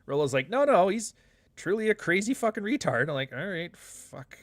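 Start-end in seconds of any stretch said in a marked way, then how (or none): 0.5-3.1 high-pitched, louder mock insistence; insistent objection. Quoting Rolo arguing about Roosh, high insistent objection. Voicing Rolo's insistent disagreement. 3.3-4.4 slow, softened giving-in; reluctant concession. Retold or imitated. Host retelling how they gave in to Rolo, slow reluctant concession. Gives in to Rolo's argument.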